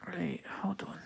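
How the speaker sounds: whisper. Real, not acted. Counting stitches under her breath.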